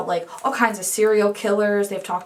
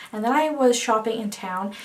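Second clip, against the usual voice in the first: usual speaking voice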